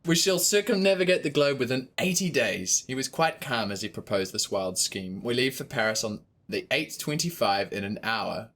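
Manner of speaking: measured storybook reading; story narration. A narrator's reading. Narrator reading Passepartout's account. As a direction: read it like an audiobook.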